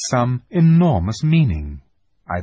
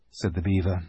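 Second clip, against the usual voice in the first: ordinary delivery, nothing marked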